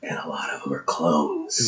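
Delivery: hushed, breathy aside; whispering. Real, not acted. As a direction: drop to a whisper for the secret. Conspiratorial aside about clones.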